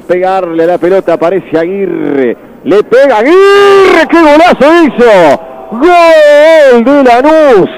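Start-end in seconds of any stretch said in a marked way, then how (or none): 2.6-7.7 excited goal call. Real, not acted. Goal scored, commentator calls it.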